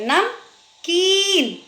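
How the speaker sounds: "नमकीन" slowly, "नम", pause, high drawn-out "कीन"; long ee. Shown as a demonstration. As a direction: stretch the ee in "नमकीन". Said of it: "बड़ी ई की मात्रा".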